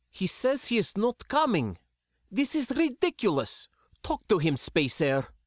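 put-on high, sharp, indignant young woman; high exasperated voice, fed up with the whole plan. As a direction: snap it out, outraged at her father. Sindra's furious outburst.

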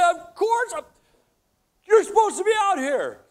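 husband snapping at his wife; exasperated retort. Retold or imitated. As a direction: snap back in frustration. Frustrated husband snaps back.

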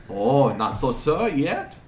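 put-on slow, drawn-out European accent; wandering foreign accent. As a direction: savor the words in a muddled foreign accent. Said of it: "his accent keeps jumping around a lot".

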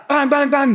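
high-pitched mimicked knocks; imitated door banging. Storyteller imitating the neighbour at the door, high urgent bangs. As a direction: rap out the bangs like a fist on a door.

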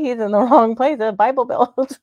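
laughing through the words; real giggly laughter breaking in. Real, not acted. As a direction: crack up at your own joke. Amused by her own joke.

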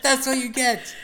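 loud, high mock taunt; gloating taunt. Gamer joining the victory act, gloating taunt. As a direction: crow it at the loser. Joins in acted gloating over kill.

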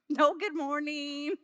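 ordinary talk

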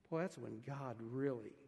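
ordinary talk

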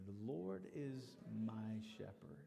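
slow, hushed whisper of the line; whispered verse. Shown as a demonstration. Pastor leading the church in the psalm, whispered. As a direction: breathe the words out softly and slowly. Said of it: "Say that with me".

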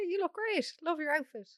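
high, lively praise; bright compliment. Retold or imitated. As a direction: gush it out cheerfully. Complimenting a stranger.

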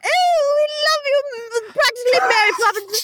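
very high mock English children; bad English accent. Children adoring their nanny, very high bad English accent. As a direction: gush in a hammed-up English kiddie voice. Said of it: "Anyway, bad English accents".

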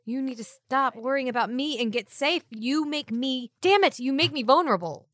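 exasperated, building to a loud burst; scold, then blurt out the confession loudly; heated protective frustration, rising at the end; frustrated outburst. Acted. Acted quarrel, frustrated confession to companion.

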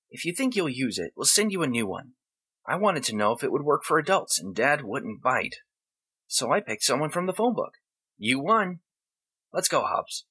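put-on high young boy voice; a kid explaining matter-of-factly; boy's voice.

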